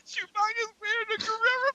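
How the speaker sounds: high, cracking laugh-talk; crying with laughter mid-sentence; helpless laughter. Real, not acted. Describing the Chewbacca photo to friends, crying laughing.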